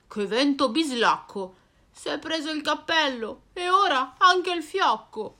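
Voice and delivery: cane brontolone, un po' più forte; voce del cane. Acted. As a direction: lamentati del vento come un cane. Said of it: "pensa il cane". (il cane borbotta col vento)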